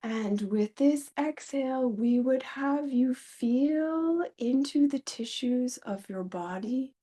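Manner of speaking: slow, measured phrases with long pauses; channeled guided meditation. Pretend. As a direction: lead listeners into the breath as a channeled being. Share a meditation.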